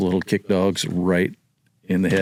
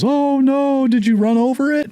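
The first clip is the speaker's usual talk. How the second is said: high, loud cry of an alarmed lady; alarmed dog owner; 4 dB louder than usual. Retold or imitated. Neighbor lady hearing about her dog, high alarmed cry. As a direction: shriek it like a shocked owner. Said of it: "She goes".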